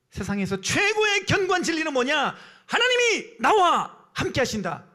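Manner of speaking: high, loud proclamation. Real, not acted. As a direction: declare it loudly, stretching the key words. Preacher proclaims the key truth. (높고 힘차게 선포하듯)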